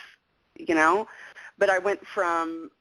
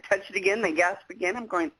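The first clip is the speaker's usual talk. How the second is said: ordinary talk, nothing marked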